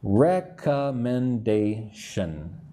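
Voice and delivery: "Recommendation" slowly as rec-com-men-da-tion; five slow syllables. Shown as a demonstration. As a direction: give each syllable of "Recommendation" its own beat. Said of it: "Five syllables".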